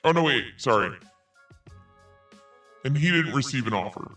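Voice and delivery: ordinary delivery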